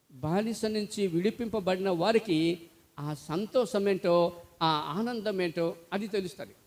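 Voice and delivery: ordinary talk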